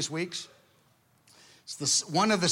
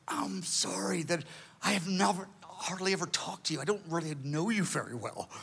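soft, regretful admission; hushed apology; 6 dB quieter than usual. Retold or imitated. Man apologizing to God for never talking to Him, hushed. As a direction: apologize quietly, trailing softer.

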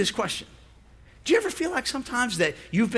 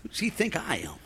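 with attitude; 9 semitones lower, 6 dB quieter than usual. Retold or imitated. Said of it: "if she would have said with attitude".

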